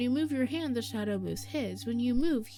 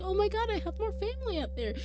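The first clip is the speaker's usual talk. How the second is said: high, thrilled pet discovering family; Momo's delighted thought; 12 semitones higher than usual. Retold or imitated. Streamer voicing Momo's thought, gleefully high. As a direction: squeal it out like a happy little pet. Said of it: "He's like".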